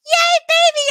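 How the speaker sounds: high, loud mock cheer; excited cheer. Teacher showing baby to her class, re-enacted excited cheer.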